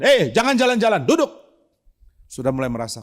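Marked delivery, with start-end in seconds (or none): 0.1-1.3 high, loud mock scold to sit down; Pak Tong scolding. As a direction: imitate the pastor snapping at walkers. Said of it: "Pak Tong mulai marahin orang".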